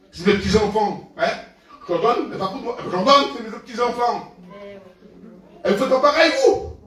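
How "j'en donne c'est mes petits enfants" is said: usual speaking voice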